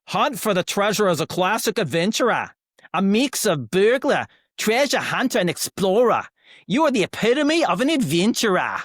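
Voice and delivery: broad, put-on Aussie read with swinging pitch; Australian accent. Shown as a demonstration. Host reading a handbook for an accent challenge, Australian accent. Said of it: "here goes my Australian".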